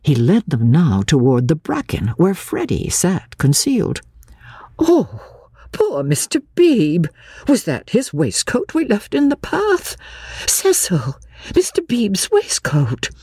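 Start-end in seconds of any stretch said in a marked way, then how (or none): 4.8-13.1 high, pitying lady's voice; dismayed exclaiming. A narrator's reading. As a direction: fret aloud, high and concerned. Honeychurch dismayed over the waistcoat.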